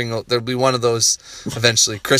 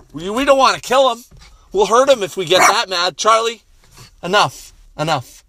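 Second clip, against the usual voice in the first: urgent calming; 8 semitones higher than usual. Real, not acted. Stopping his dog from going after Josh, urgent. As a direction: rein in a wound-up dog, urgently.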